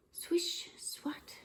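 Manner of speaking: soft whispered sound words. Acted. Teacher whispering the sound words to the class. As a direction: breathe them out.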